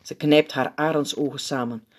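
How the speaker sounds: usual speaking voice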